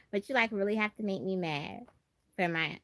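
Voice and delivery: usual speaking voice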